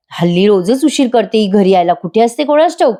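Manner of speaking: ordinary talk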